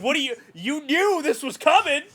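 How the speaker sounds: loud, high-pitched retold protest; mock accusation. Son blaming Mom for not warning him, loud mock outrage. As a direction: accuse in comic outrage, voice pushed way up.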